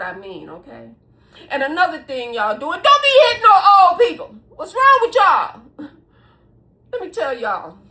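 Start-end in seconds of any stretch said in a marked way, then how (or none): 2.8-5.6 loud, high, rapid telling-off; raised-voice reprimand; indignant scolding. Real, not acted. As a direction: shout them down like a fed-up elder. Scolding viewers for hitting old people.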